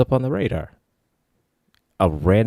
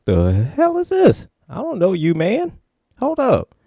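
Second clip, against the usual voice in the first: high, slow wary disbelief; suspicious; 11 semitones higher than usual. Retold or imitated. He confronts the stranger, high and wary. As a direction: back off from a strange man.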